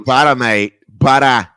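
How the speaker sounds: loud mock New Zealand accent; mock guest's accent. Host mimicking guest's way of saying butter, mock New Zealand accent. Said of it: "poor impression of your accent".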